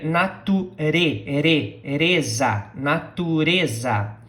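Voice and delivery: natureza broken into syllables. Shown as a demonstration. Teacher splits natureza for students. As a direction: say na-tu-re-za slowly, piece by piece. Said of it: "Pay attention here".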